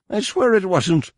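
terrified captive pleading innocence; desperate cry. A narrator's reading. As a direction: beg for your life. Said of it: "Shun Mai cried desperately".

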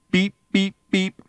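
high mock electronic beeps; beeping. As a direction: imitate the clock's beeps. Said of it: "said Ford".

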